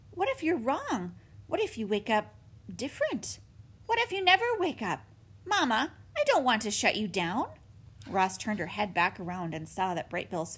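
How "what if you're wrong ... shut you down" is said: a lightly voiced little gosling, higher and anxious; a young bird begging his mother not to risk it; young gosling, worried. Gosling Bright Bill pleads.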